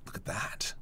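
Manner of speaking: whispered, almost to himself, impressed; awed whisper. Real, not acted. To viewers, admiring the finished panel, in an awed whisper. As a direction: breathe it out in quiet awe.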